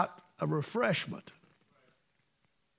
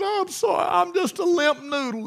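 much higher, tearful squeaky voice; imitating crying; 11 semitones higher, 3 dB louder than usual. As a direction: choke it out like a big man weeping. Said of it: "And he cried".

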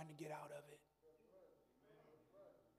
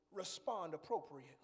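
normal talking voice

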